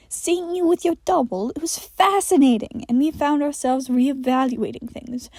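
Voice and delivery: sinister creature explaining; creepy entity. Acted. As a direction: explain with unsettling patience. Michael delighted entity voice.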